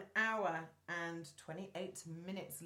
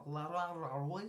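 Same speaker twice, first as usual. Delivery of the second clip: imitating an exaggerated French accent; la-la through a mock French accent; Colin; 3 semitones lower than usual. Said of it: "this is where Colin would go".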